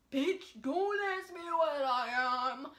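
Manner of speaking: loud, drawn-out mock outrage; upset retort. Imitating mixed people asked what they are, hammed-up upset. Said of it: "mixed people get upset".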